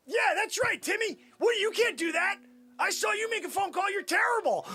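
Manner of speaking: high-pitched, heated taunting; berating. Acted. Boss berates Timmy over his phone call, heated. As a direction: tear into a useless underling.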